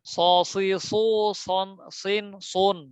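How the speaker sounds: স, সি, সু, সন, সিন, সুন thick and rounded; heavy syllables. Shown as a demonstration. Teacher drilling the whole row, heavy letters. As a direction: chant স, সি, সু, সন, সিন, সুন full-mouthed. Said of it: "সিনের মতো যেন না হয়".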